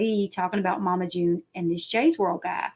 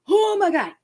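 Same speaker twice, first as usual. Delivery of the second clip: retold surprise; 10 semitones higher, 3 dB louder than usual. Reacting to the podcast news.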